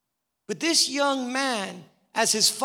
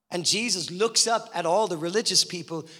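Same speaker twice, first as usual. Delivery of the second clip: usual speaking voice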